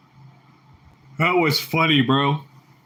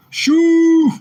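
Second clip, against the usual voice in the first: drawn-out shout; 9 dB louder than usual. Shown as a demonstration. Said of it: "Say it with a deep voice and loudly".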